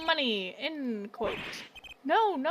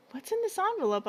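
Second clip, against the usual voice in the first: ordinary talk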